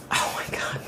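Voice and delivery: hushed breathy whisper. Real, not acted. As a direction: breathe it out in disbelief. Stunned aside to viewers.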